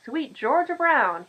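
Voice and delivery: loud, slow, high call across the yard; hearty yell. Acted. Mister Gowan yelling hello to the girl from his truck. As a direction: stretch it out, hollering a greeting. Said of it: "he yells out the window".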